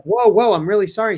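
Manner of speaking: mock startled and apologetic; startled apology. People apologizing to the screaming robot, startled.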